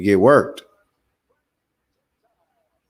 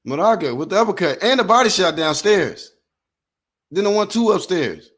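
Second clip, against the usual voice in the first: play-by-play; 6 semitones higher than usual. Acted. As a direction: lively fight commentary, pitched up on the big shots. Live play-by-play of the fight.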